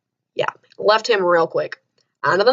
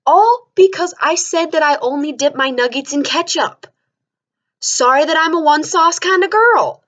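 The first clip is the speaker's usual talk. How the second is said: outraged rant; 6 semitones higher than usual. Real, not acted. Ranting, outraged at the memory.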